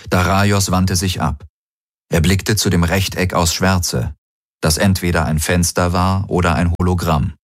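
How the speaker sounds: ordinary delivery, nothing marked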